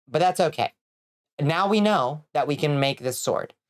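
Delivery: usual speaking voice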